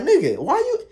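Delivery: high, squeaky angry uncle; mock angry uncle.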